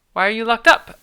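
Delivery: loud demanding question to the prisoner. Acted. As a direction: shout it across the dungeon. Character demanding an answer.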